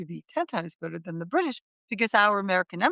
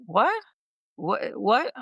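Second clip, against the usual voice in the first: baffled questions, pitch jumping up sharply; puzzled, as if not understanding the request; mock confused; much slower than usual. Egyptian clerk to an American visitor at the embassy desk, confused. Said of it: "he kinda looked a little confused".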